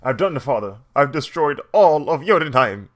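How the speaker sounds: mock triumphant Loki. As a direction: announce it proudly to father. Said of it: "and going".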